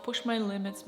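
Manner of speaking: normal talking voice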